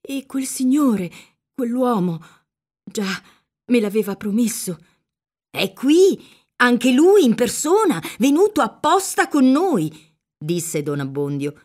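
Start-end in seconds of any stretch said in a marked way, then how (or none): 0.0-4.8 hesitant dread, broken by pauses; understated timid fear; fearful. A narrator's reading. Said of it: "tremanti di spavento e d'orrore". 5.5-9.9 high, loud, flustered priest; excited, swooping insistence; eager reassurance. A narrator's reading. Priest urges girl, flustered.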